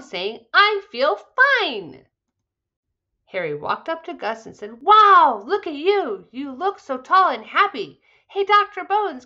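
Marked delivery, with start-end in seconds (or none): 0.5-2.1 high, loud and very slow, relieved joy; slow, beaming declaration; happy and strong. Acted. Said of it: "walked out happy and strong". 4.8-9.2 amazed wow. Acted. Harry amazed at healed Gus.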